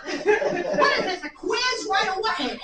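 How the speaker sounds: slow, laughing mock indignation. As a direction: chuckle through the complaint. Parent answering quiz question, laughing.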